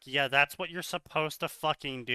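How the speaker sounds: usual speaking voice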